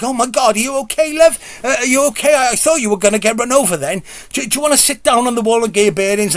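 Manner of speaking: put-on high, fussing elderly lady, fast at the end; worried old lady. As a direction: fuss over him like a worried granny. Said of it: "the elderly lady is like".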